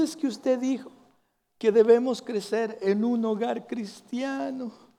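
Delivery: voz de niño algo más aguda; child voice. Retold or imitated. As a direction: imita suavemente a un niñito. El pastor imita al niño. (un toque de voz infantil)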